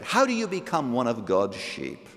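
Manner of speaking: ordinary talk, nothing marked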